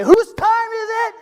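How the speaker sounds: high, slow, loud rally call; hype call. Shown as a demonstration. Speaker calling out to the crowd, loud, high and slow. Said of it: "I'm gonna say".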